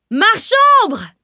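very high, loud alarm cry; shouting at full lungs. A narrator's reading. Robbed statuette owner raising the alarm, shouting. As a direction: bellow it high across the market. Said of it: "s'époumonna le propriétaire de la statuette".

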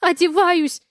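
high-pitched hurried answer; flustered reply. A narrator's reading. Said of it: "отвечаю".

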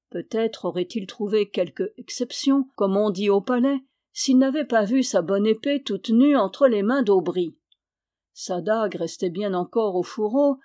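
plain reading voice

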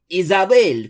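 put-on older sister shouting at Isabel. A narrator's reading. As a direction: bark the name across the room. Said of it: "крикнула Аланис".

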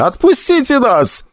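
desperate plea. Acted. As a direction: plead for your life.

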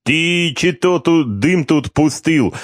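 high, slow, wincing Georgian complaint; Georgian accent. A narrator's reading. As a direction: grumble at the smoke in a Georgian accent. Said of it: "поморщился Мзариули".

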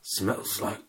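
slow, toneless superhero rasp; hammed-up brooding growl with a pause before the punchline; Batman voice. Birdman grumbling to Regan about the smell, in a Batman rasp. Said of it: "Christopher Nolan, Batman-stemmen".

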